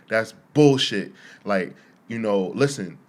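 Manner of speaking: loud, heated complaint; going ham. Retold or imitated. Alvin arguing with the lady, loud and heated. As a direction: demand to be let in, loud and fed up. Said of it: "he's just going ham".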